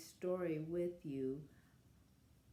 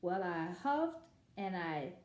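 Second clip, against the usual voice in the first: lively read-aloud as the wolf telling his side; story narration; 5 semitones higher, 3 dB louder than usual. A narrator's reading. Wolf narrator telling children his side, storytelling voice. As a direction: read it like a sly storyteller to kids. Reads picture book as wolf.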